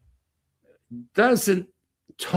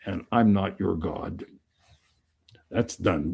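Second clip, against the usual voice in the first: ordinary talk, nothing marked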